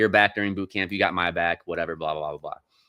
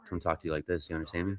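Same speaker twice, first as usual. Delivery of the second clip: lowered, softer, menacing; low menacing quote; 3 semitones lower, 8 dB quieter, much faster than usual. Retold or imitated. Drill instructor leaning in close to a recruit, quiet menace. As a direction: lean in and threaten quietly. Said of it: "he just".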